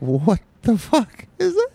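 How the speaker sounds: high, drawn-out retold disbelief; stunned disbelief. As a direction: stretch it out high, acting baffled and creeped out. Retelling his shock at the creature.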